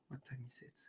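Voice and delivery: barely audible whisper to oneself; whispered aside. Real, not acted. Streamer checking the clock to himself, whispered aside. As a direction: whisper it under your breath.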